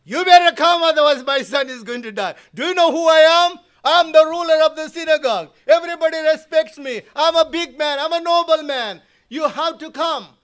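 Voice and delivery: loud, high, fast arrogant demand. Retold or imitated. Nobleman demanding that Jesus come to his house, loud and arrogant. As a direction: bark it like a proud ruler who expects obedience.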